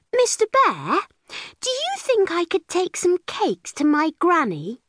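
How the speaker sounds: high, innocent asking; sweet little girl. A narrator's reading. Clever girl sweetly asks bear to let her go. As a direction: ask the bear nicely with a plan.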